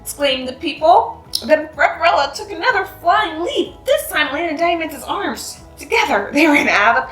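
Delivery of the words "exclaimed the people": ordinary delivery, nothing marked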